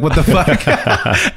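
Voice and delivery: mock exasperation through a laugh; laughing retort. Retelling two drivers waving hands, laughing mock exasperation.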